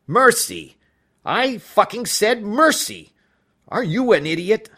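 high, loud enraged yelling; furious shouting. Acted. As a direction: scream at her in outrage. Judge furious at mistress.

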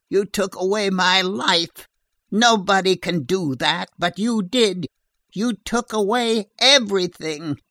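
climbing from bitter to shrill and loud; rising angry shout. A narrator's reading. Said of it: "her voice grew into a shrill, angry shout".

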